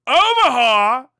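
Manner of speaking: usual speaking voice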